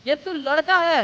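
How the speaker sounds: high, loud indignation; father scolding son over his partner, loud and high; outraged. Acted. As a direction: throw it out in disbelief.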